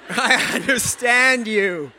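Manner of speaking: slow, laughing fondness. Real, not acted. Preacher laughing as he tells David he understands him. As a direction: laugh through it, fondly.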